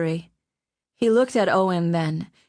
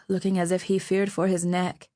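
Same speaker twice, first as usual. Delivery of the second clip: plain reading voice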